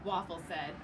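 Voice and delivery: ordinary delivery, nothing marked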